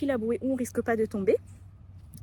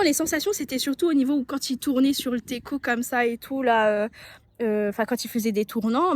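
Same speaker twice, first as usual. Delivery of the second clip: ordinary delivery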